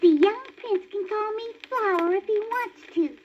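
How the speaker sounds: high bashful little skunk; shy skunk voice. A narrator's reading. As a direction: play the shy skunk sweetly. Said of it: "said the skunk".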